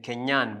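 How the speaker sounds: "Kenyan" ending on an audible N; French feminine "Kenyan", closed with a clear N; feminine, N pronounced. Shown as a demonstration. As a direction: sound the final N of "Kenyan". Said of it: "we pronounce the N".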